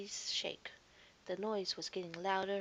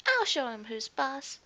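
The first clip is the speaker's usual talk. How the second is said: louder, higher cocky challenge; tough little cub; 4 semitones higher, 8 dB louder than usual. A narrator's reading. Faetua facing the leopard, bold and cocky. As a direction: show the leopard who's boss.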